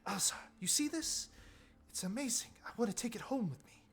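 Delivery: high-pitched, awestruck character voice; eager discoverer showing off a wonder; fascinated tinkerer. Acted.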